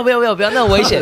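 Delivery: high, laughing mock plea of onlookers; imitating bystanders pleading. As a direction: mimic the panicked people holding him back. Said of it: "旁边的人看到我榔头". (笑着模仿旁人劝阻)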